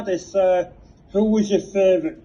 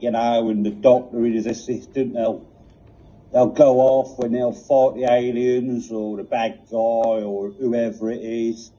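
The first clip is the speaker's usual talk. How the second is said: lower, weary Cockney police box; Cockney accent; 6 semitones lower than usual. Acted. The TARDIS answering Reg, in a world-weary Cockney accent. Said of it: "such a pronounced, uh, Cockney accent".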